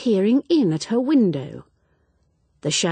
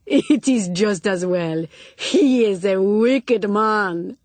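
slow, loud, teasing reproach; Spanish lady pretending to be shocked; mock-scandalised senora; 3 semitones higher, 5 dB louder, slower than usual. A narrator's reading. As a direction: wag a finger at the wicked man. Senora scolds the joker.

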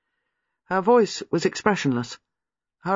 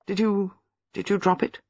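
hesitant; 5 semitones higher than usual. Acted. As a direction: ask it nervously, stumbling over the start. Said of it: "Giles asked hesitantly".

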